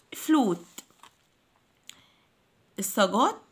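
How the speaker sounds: normal talking voice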